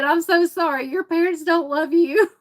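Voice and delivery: high-pitched cooing to a dog; mock pity. Mock pity for dog, laughing after.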